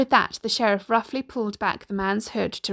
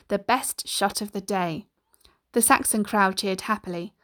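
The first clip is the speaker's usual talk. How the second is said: usual speaking voice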